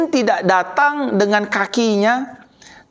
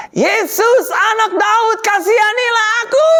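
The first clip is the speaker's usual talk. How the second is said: high, sustained cry for mercy; desperate shout; 12 semitones higher than usual. Acted. Blind beggar Bartimeus cries out to passing Yesus, desperate shouting. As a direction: shout it out so he hears you over the crowd. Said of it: "Dengan cara berteriak".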